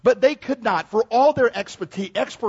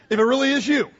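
higher, louder, pointed; doubtful challenge; 6 semitones higher, 4 dB louder, faster than usual. Acted. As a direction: push him to prove it. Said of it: "in case it's somebody else walking on the water".

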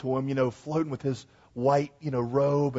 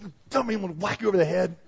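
quick, higher mock threat; hammed-up angry Jesus; mock scolding; 6 semitones higher, 3 dB louder, faster than usual. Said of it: "He didn't take out an oar and like".

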